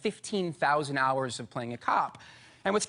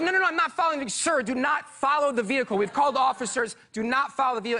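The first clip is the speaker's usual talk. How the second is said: high, loud and flustered, both voices at once; flustered back-and-forth; 7 semitones higher, 4 dB louder, faster than usual. Retold or imitated. Arguing with the 911 dispatcher, high and flustered. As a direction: tumble over the words in a panic. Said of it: "And I was like".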